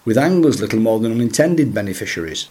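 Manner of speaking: ordinary talk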